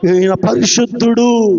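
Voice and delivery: low-pitched, measured declaration; low retold declaration. Preacher quotes the spirit naming the Holy One, low and restrained. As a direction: say it low, holding back the cry. First take of demon's words.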